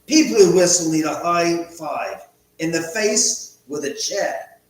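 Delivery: mock South African accent, drawn out and rising. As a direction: read the chat line in a put-on South African accent. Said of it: "South African people say".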